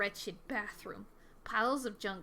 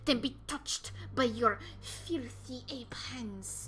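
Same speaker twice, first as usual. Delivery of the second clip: put-on disdainful cat in a light French accent, louder; 2 semitones higher, 4 dB louder, slower than usual. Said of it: "I need to give her a French accent".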